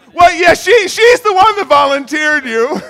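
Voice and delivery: high, loud, excited call-out. Real, not acted. Preacher telling Chris who volunteered him, shouting. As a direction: shout it across the room, teasing.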